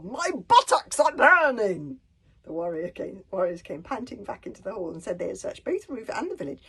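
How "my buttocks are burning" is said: high, loud and slow complaint; pained shout. A narrator's reading. Warlord complains of his burning buttocks, loud wail.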